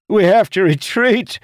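high, loud panicked yell; screaming retreat. A narrator's reading. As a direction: scream it to the whole crew in panic. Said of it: "Jacob screamed".

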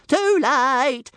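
high, loud sing-song taunt. A narrator's reading. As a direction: sing it out teasingly. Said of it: "sang Toothless".

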